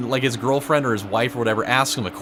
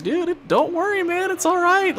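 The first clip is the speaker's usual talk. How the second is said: reassuring. Retold or imitated. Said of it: "I was like".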